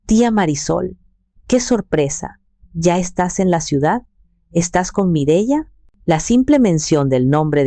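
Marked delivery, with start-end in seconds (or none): none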